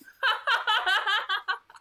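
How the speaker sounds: high, loud burst of laughter; hearty real laugh. Real, not acted. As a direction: laugh out loud, amused by the chapter. Laughs after reading the chapter.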